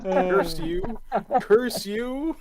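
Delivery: high, loud hammed-up villain cry; mock curse. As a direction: shake your fist like a cartoon villain. Mock outrage at teasing.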